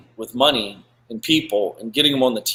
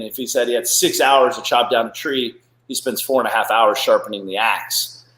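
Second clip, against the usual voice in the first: ordinary delivery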